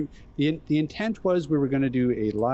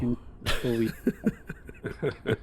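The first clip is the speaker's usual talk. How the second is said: laughing; 4 dB quieter, much slower than usual. Real, not acted. Laughing disbelief at own show.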